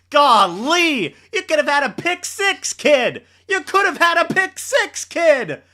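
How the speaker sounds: high, loud, disbelieving rebuke; exasperated scolding. Real, not acted. Scolding player after the pick.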